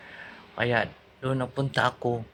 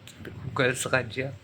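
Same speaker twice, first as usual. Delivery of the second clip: ordinary talk, nothing marked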